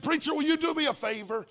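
high, pleading mock-tearful ask; a touch of tearful pleading; weeping plea. Courtney calling her preacher, high and pleading. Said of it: "with a weeping voice".

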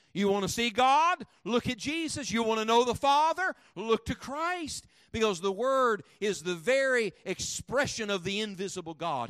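loud, rising pulpit cadence, hammering each line; fiery revival preacher; preaching at full force. Acted. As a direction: preach it hard to the congregation.

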